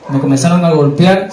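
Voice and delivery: ordinary delivery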